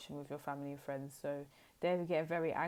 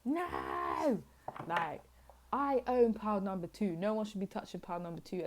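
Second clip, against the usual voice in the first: possessive ex; 4 semitones higher, 3 dB louder than usual. Retold or imitated. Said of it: "they're like".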